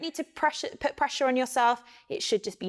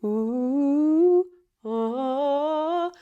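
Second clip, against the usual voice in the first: closed mouth; 4 semitones higher, 4 dB louder than usual. Shown as a demonstration. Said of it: "'Cause if we just go".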